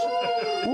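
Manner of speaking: high-pitched whoop; excited whoop. Real, not acted. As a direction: let out a high, delighted whoop. Excited whoop over a viewer.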